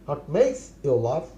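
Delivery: very slow, each word laid out for learners; slow model phrase. Shown as a demonstration. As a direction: spell it out slowly for the class. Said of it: "teacher models English phrase". (ধীরে ধীরে শেখানোর ভঙ্গিতে)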